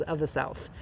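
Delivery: ordinary delivery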